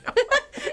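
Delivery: short burst of high laughter. Real, not acted. Host laughing about her fifteen-year-old, high laugh. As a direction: laugh it off, bright and quick.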